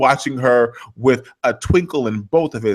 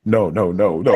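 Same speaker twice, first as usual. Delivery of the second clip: denying crying; 5 semitones lower than usual. Retold or imitated. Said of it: "And he's like".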